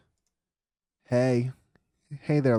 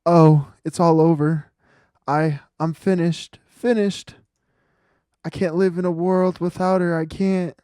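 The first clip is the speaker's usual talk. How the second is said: hammed-up grief; 6 semitones higher, 10 dB louder than usual. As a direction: wail about the lost girlfriend. Voicing the grieving client.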